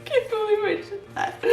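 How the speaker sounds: high-pitched, giggly; laughing disbelief. Real, not acted. Owning up to the botched joke, laughing disbelief. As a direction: giggle through the admission. Still laughing at own blunder.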